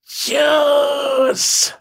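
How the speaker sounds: high, eerie ringing call; eerie creature cry. Acted. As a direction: call it out like a spirit. Creature calls its word.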